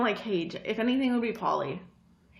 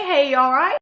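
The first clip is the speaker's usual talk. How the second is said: louder, higher British greeting; British accent; 4 semitones higher, 8 dB louder, faster than usual. Acted. Proving it to co-host, punchy British accent. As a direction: throw out a British greeting with rising end. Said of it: "I did mine even more British".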